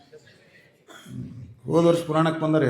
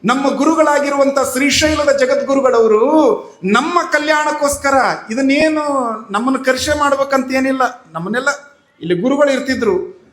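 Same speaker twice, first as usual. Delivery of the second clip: loud, higher, fervent sermon voice; preaching at full force; 7 semitones higher, 6 dB louder than usual. Acted. Preacher urging devotees to have faith, fervent and loud. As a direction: drive the moral home to the crowd. Preacher drives home the moral.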